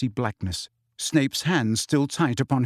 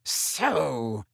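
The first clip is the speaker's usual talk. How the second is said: menacing Snape; slower than usual. A narrator's reading. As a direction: draw it out, dangerously controlled. Furious Snape confronts Harry.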